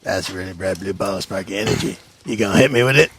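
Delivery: low, sly hobgoblin taunt; gravelly sly hobgoblin. Acted. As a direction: taunt them like a smug hobgoblin.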